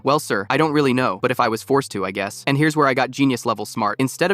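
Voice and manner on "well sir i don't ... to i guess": normal talking voice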